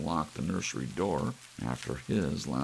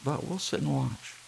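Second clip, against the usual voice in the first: put-on much higher childlike chirp; child voices; 10 semitones higher than usual. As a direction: speak as the kids. Children answer parents.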